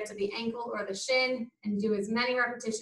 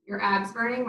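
ordinary talk

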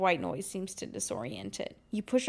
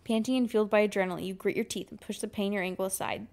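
ordinary delivery

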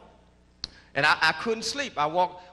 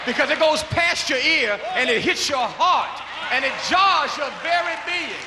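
rising, louder preacher's fervour; fervent preaching; 7 semitones higher, 5 dB louder than usual. Real, not acted. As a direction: preach it to the heart. Preaching about God's voice.

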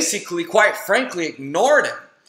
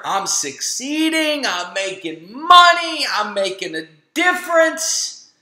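mock proud, higher and louder; self-mocking boast; 6 semitones higher, 6 dB louder than usual. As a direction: puff up like a success story, ironically. Mocking his own success talk.

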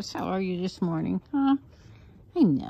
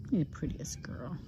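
softer whispered tender pet talk; hushed fond whisper; 4 semitones lower, 9 dB quieter than usual. Real, not acted. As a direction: whisper gently while grooming. Whispering to dog.

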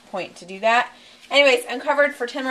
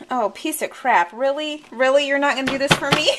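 exasperated; 5 semitones higher, 6 dB louder than usual. Real, not acted. Fighting a stubborn apple.

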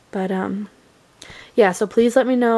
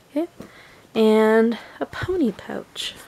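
ordinary delivery

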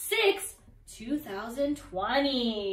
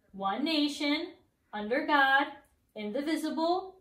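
ordinary delivery

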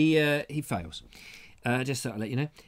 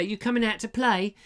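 put-on high-pitched kid voice; child asking to play; 6 semitones higher than usual. Kid calling for a friend to play, high child voice. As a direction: ask like a little kid on the doorstep. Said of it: "I'd say".

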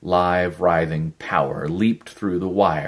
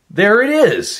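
much higher, loud yell of discovery; a triumphant yell of discovery; 11 dB louder than usual. Acted. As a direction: shout it out as it hits him. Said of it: "he yelled".

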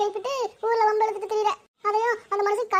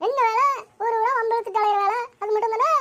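normal talking voice

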